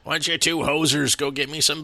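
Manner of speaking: gruff movie dad. Retold or imitated. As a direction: bark it like a lazy dad ordering his boys around. Quoting the father from the movie.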